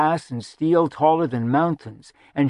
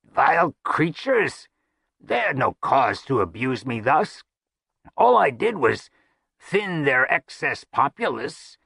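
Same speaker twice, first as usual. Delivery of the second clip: high, croaky complaining voice; croaking; 4 semitones higher than usual. Acted. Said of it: "half a groan and half a croak".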